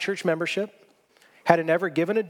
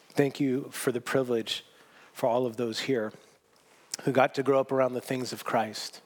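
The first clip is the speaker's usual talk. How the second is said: closing prayer; 2 semitones lower than usual. Real, not acted.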